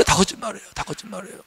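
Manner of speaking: fading soft repeat; quiet echo. Acted. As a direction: let the words fade away.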